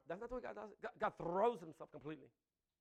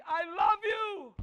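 slow, loud, higher shout of love; God's loud declaration; 10 semitones higher, 14 dB louder, much slower than usual. Acted. God calling out to his people, slow loud shout. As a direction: call it out like God from the clouds. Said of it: "He's speaking very cloudly".

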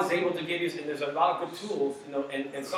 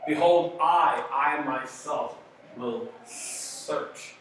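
very slow, drawn-out "I, I myself will search"; slow reading; much slower than usual. Shown as a demonstration. Pastor reading the verse slowly, drawn out. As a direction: read it slow so it sinks in. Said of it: "That caught my attention".

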